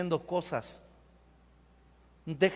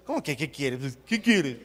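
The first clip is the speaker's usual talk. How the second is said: lower, put-on comeback voice; mock retort; 5 semitones lower than usual. Preacher acting a reply to Jesus, mock retort. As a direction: throw the question back in a put-on voice. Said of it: "¿Qué le hubieras dicho?".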